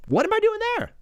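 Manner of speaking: very high-pitched disbelief; incredulous squeal. Pretend. Streamer questioning own remark about Mexicans, incredulous squeal.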